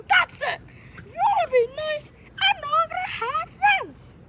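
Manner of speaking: high, loud, hurt protest; worked-up cartoon tantrum; upset outburst. Acted quarrel, character storms off.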